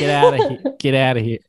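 badly faked Southern accent that lands as Australian; mock Southern sliding into Australian; Southern accent gone Australian. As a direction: attempt Southern, come out sounding Australian. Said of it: "That's Australian".

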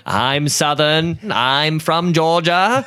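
slow, drawn-out Southern drawl, put on; hammed-up Southern accent, stretching every word; mock Southern accent. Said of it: "kinda sounds like a Poughkeepsie accent".

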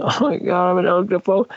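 high-pitched mock cry of panic; retold crying. Retelling the woman with glue in her eye, crying. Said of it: "and she just cries".

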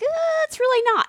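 high doubtful uh; mock-skeptical sister. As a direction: lightly mimic the doubting sister. Sister's deadpan quoted.

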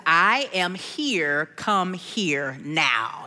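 low, very slow maternal command; deep, deliberate mother-means-business tone; stern mommy voice. Retold or imitated. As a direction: lay down the law, one word at a time. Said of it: "I put my mommy voice on".

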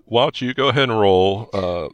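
ordinary talk

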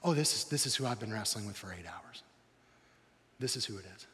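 trembling awe. Retold or imitated. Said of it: "You can almost hear the tremble in his voice".

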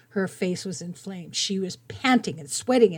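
normal talking voice

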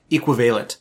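"equivalent" said ee-kwuh-VAY-lent, stress on VAY; mock "equivalent" with a long VAY in the middle; teacher's odd pronunciation. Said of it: "a math teacher right now who pronounces".

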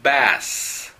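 "bass" with a clean final s; English word demo. Shown as a demonstration. Teacher reading the chart word, careful demo. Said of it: "Cuarta fila".

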